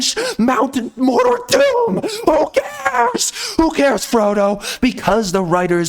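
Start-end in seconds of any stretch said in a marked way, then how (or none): 2.3-3.5 very high, crying mock wail; mock whiny shriek. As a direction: wail it like a whining child. Mocking Frodo's quest.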